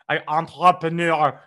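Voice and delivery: ENTREPRENEUR loud in mock French, final R swallowed; obnoxious fake French. Shown as a demonstration. Host showing off French words, obnoxious French accent. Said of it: "most obnoxious way to pronounce a word".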